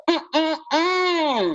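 mock refusal. Acting out the Admiral turning him down, mock refusal. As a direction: hum a sassy no.

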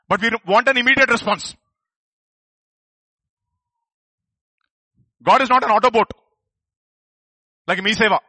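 high, loud emphatic preaching. Acted. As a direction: drive the point home to the crowd. Preaching climax.

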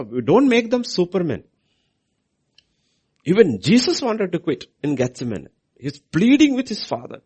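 loud, high preacher's cry; loud, high preaching; fiery preaching. Acted. Preaching at full force.

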